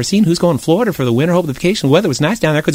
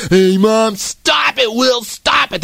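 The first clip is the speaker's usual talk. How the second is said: whiny high protest; 8 semitones higher, slower than usual. Acted. Brothers quarrel, calling mother.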